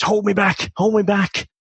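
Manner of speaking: mock schoolyard tough. Schoolboy posturing at his mates, mock bravado. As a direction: play the lad who begs his mates to hold him back.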